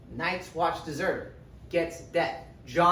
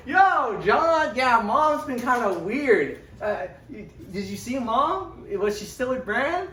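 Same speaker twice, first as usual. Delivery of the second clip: high, loud eager brother voice; excited older brother; 9 semitones higher, 7 dB louder, slower than usual. Retold or imitated. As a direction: bounce in as the cheerful big brother. Robb greets Jon after Mom.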